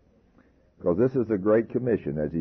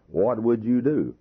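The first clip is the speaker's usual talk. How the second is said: ordinary talk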